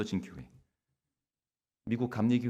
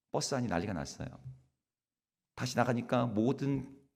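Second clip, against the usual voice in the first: ordinary talk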